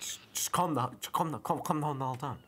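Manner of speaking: fed-up yell at him; exasperated shout. Real, not acted. Player telling the hiker to calm down, loud.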